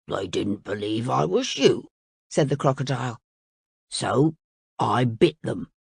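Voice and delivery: a blunt beast owning up; crocodile. Acted.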